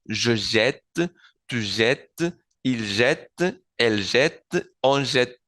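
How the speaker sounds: "jette" con la primera E sonora; E pronunciada. Shown as a demonstration. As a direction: say "jette" slowly with a sounded first E, like "zhet". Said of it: "Hace que la E suene". (primera E de "jette" bien pronunciada)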